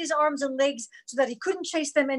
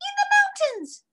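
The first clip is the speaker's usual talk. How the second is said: very high squeaky toy monkey; 3 dB louder than usual. A narrator's reading. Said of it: "she said in a squeaky voice".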